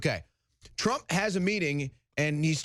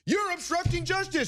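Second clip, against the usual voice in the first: mock accusation; 9 semitones higher than usual. Mocking Democrats' accusation.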